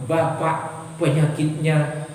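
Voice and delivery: low, slow pronouncement; grave doctor. Acted. Doctor breaking absurd news to patient, grave. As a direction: deliver the diagnosis slowly and low.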